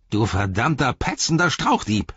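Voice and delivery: high, angry orc cry; screamed insult. Acted. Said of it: "schrie er".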